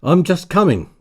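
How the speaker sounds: high and loud, through a door; calling out. A narrator's reading. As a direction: call out to someone outside.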